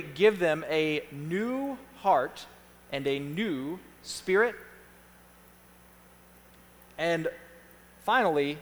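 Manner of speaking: drawn-out words, long pauses between phrases; slow dictation. Real, not acted. Pastor reads last points for people writing them down, slowly. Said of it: "I'm gonna read them slowly".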